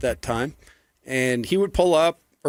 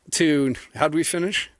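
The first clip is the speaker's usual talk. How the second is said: ordinary talk, nothing marked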